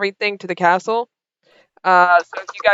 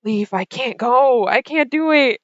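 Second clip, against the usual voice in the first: Lucian; 4 semitones higher than usual. Acted. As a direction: plead helplessly, stretching the words. Wide-eyed Lucian pleads with Leaf.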